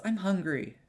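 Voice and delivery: put-on elf girl. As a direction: pipe up as a hungry elf child. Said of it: "the elf girl says".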